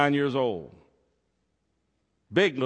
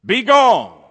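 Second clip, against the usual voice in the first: loud, high, stern command; 10 semitones higher, 13 dB louder than usual. A narrator's reading. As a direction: shout the line sternly like a stage innkeeper. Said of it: "repeated again very sternly".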